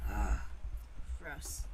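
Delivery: ordinary delivery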